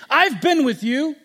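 elder son accusing father, furious; angry son. Acted. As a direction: throw every grievance at the father. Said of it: "He's angry".

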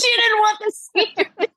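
high, amused, laughing through the words; laughing disbelief. Real, not acted. Telling a friend about mom, laughing high. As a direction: squeak it out, tickled.

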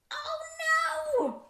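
high-pitched wail of dismay; comic horror. Acted. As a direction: moan in mock despair. Horrified by the book's words.

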